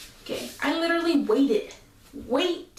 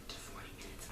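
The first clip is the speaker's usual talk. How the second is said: whisper; 24 dB quieter than usual. Real, not acted. Confiding aside to viewers.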